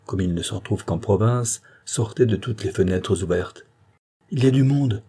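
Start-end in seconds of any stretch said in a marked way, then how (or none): 4.3-5.0 higher, mildly alarmed cry; a touch of panic at a discovery; terrified exclamation. A narrator's reading. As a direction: exclaim with understated fright. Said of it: "s'écria Soratine terrifiée".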